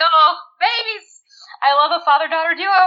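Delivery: fond gushing. Retold or imitated. Fan adoring a father-daughter duo, gushing high coo.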